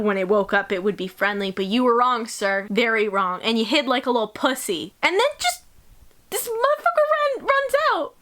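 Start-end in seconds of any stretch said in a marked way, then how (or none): 5.0-8.1 very high, incredulous squeal; incredulous retelling. Retold or imitated. Reader recounts the monster running off, high-pitched disbelief.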